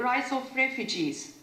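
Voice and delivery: normal talking voice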